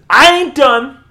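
high, loud defiant shout; retold yell. Connor spins away to keep yelling at the family, shouting. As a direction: burst out loudly, refusing to leave. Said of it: "keeps yelling at this family".

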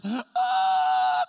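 very high loud yelp; dog's collapsing yelp. Retold or imitated. The dog collapsing after the bite, very high loud yelp. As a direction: squeal like a bitten dog collapsing.

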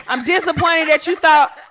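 loud angry dismissal; furious rant. Real, not acted. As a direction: cut him off like an umpire. Venting rant at the guy.

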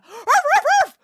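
high, yappy barks; imitated dog woofs; dog barking. A narrator's reading. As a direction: bark like an excited dog. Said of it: "barked Pumpkin".

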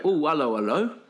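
mock English "hello, hello" come-on; pommy charity guy. Pommy guys greeting an attractive girl, mock English accent. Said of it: "they go".

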